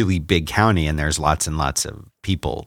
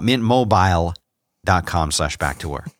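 ordinary talk

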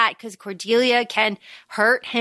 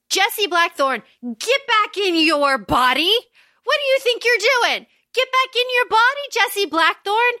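frantic scolding; 8 semitones higher than usual. Retold or imitated. Lucy quoted shouting at Jesse.

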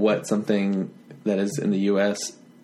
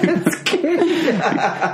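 laughing through the words; slow, amused repeat; cracking up as you say it; 12 semitones higher, much slower than usual. Real, not acted.

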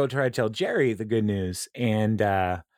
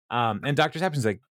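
normal talking voice

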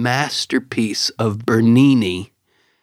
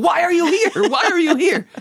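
high-pitched, insistent imitation of the woman; Italian lady. Retelling the Italian lady's question to co-host, high amused imitation. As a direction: mimic her urgent question, way up high. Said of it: "no wonder she was saying".

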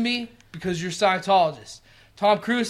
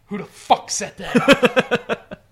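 slow, laughing mock outrage through salad; hammed-up angry question; mock mouthful yell; 3 semitones higher, 4 dB louder, much slower than usual. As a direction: drag it out with a full mouth. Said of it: "He goes".

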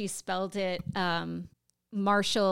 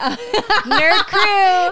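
laughing catchphrase; 10 semitones higher, 12 dB louder than usual. Real, not acted.

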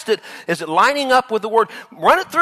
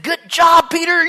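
higher, louder cheerful praise; hearty praise; 11 semitones higher, 5 dB louder than usual. Acted. Jesus congratulating Peter, with loud, high cheer. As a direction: praise him like a proud coach. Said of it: "And Jesus said".

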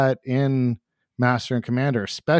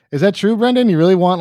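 higher, louder pressing question; teasing "is that true, Brendan" pitched up; mock incredulous; 7 semitones higher, 6 dB louder, faster than usual. Real, not acted. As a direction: push him on it in playful disbelief. Pressing the friend again.

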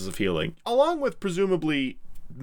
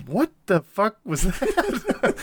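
higher, louder, slow astonished outburst; stunned disbelief; 9 semitones higher, 4 dB louder, slower than usual. Retold or imitated. Pippin gaping at Gandalf's box jump, slow stunned outburst. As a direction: drag it out in shock. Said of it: "Pippin remarks".

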